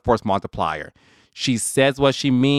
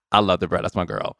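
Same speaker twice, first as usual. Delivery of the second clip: ordinary delivery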